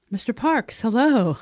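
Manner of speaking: high, loud nervous blurt; blurted greeting. A narrator's reading. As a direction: burst out the greeting too eagerly. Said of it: "I blurted".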